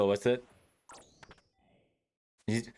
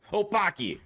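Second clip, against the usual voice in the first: mock Russian; 11 semitones higher than usual. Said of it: "Is that Russian?".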